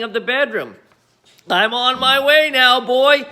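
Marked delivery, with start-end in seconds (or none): 1.5-3.3 higher and louder call from above; grandma calling down to George. Acted. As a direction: call down to the boy below. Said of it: "she called down to George".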